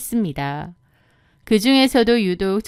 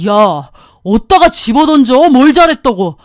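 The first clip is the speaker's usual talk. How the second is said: loud husband shouting; angry yell; 2 semitones higher, 8 dB louder than usual. Acted. Husband yelling at wife over the thrown keys, angry.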